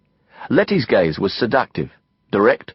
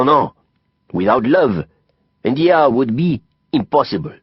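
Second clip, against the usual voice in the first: put-on higher French-accented voice, lecturing with passion; Didier, emphatic French friend; 7 semitones higher than usual. A narrator's reading. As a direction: expound like a theatrical Frenchman.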